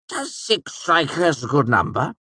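high excited nobleman; bright, laughing older count; Count Threep voice. A narrator's reading.

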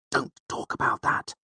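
hissed whisper, breathy and tense; urgent whisper. A narrator's reading. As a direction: hiss it right into his ear. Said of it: "Bast hissed in his ear".

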